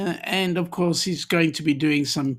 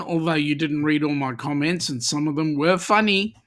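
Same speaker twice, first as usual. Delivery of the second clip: ordinary delivery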